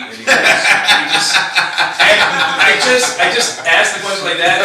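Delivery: laughing embarrassed retelling. Real, not acted. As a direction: crack up at own gaffe. Laughing at himself.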